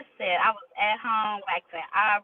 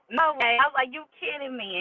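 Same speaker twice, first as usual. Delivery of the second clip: stunned disbelief; 7 semitones higher than usual. Retold or imitated. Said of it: "And I was like".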